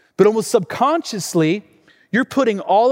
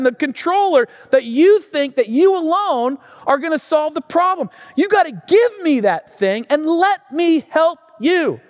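high, emphatic plea with slow, stretched key words; impassioned preaching; 8 semitones higher, 4 dB louder than usual. Acted. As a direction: drive each phrase home like a preacher. Preaches through dad's plea.

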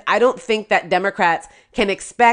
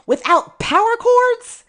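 very high, slow questioning disbelief; scandalized high-pitched question; incredulous; slower than usual. Real, not acted. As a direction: stretch it out high, as if unbelievable. Outraged disbelief at voting machine story.

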